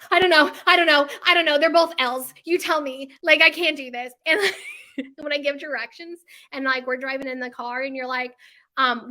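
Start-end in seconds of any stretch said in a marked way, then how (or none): 0.0-4.1 loud, high flustered "I don't know" over and over; mock panic. To someone asking left or right: loud, high mock panic. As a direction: act out panicking when put on the spot. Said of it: "I go in panic mode and I'm like".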